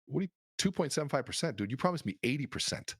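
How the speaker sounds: retelling how he protested at the broker's rate; indignant outburst. Retold or imitated. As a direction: play the betrayed rookie, voice jumping up. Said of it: "I'm just mad at him".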